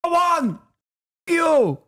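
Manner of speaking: hammed-up Israeli accent, outraged and shrill-high; mock Israeli accent. Mocking an offended pro-Israel fan in an Israeli accent. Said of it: "I thought it was pretty good Israeli accent".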